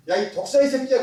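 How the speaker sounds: acted rebuke. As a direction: call it out like a rebuke. Acting out a scriptural rebuke.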